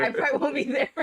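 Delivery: high, laughing repeat. Retold or imitated. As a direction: giggle while quoting. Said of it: "Of course, I'm an atheist".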